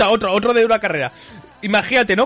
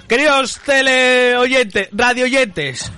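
higher, showy radio host greeting; presentador de radio; 4 semitones higher, much slower than usual. Pretend. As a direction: announce it to the listeners with gusto. Arranca su cabecera como presentador. (presentador de radio entusiasmado)

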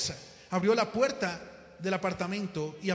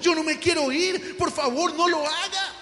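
high, loud, drawn-out plea; desperate begging; 7 semitones higher, 6 dB louder, slower than usual. Acted. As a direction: beg for your life, slowly and loudly. José's final desperate begging. (ruego desesperado, agudo y fuerte)

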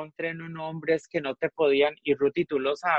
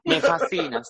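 laughing while telling her; 14 semitones higher, 5 dB louder than usual. Retold or imitated. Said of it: "yo le digo".

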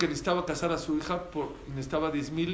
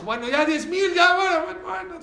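much louder, higher fed-up yielding; exasperated giving in; 13 semitones higher, 9 dB louder than usual. Acted. Merchant caving to the haggling, loud and fed up. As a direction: throw up your hands and agree.

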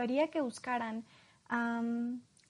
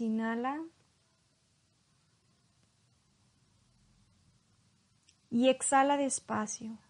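calm hypnosis guide, long pauses. Acted. Leads a hypnosis induction. (voz lenta de hipnotizador)

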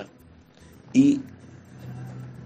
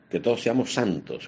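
usual speaking voice

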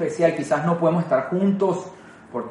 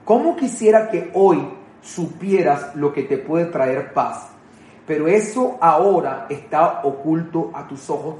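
slow scripture reading; slower than usual. Acted. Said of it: "quiero que escuches esto que dijo Jesús".

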